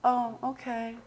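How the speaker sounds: mock meek little "oh, okay"; meek acceptance. To the casting people, mock meek acceptance. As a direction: imitate a pushover giving in softly. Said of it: "not gonna just sit here and say".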